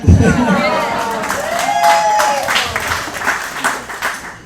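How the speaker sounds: loud high burst of laughter; a real belly laugh, pitched high; big real laugh. Real, not acted. Big laugh after the name.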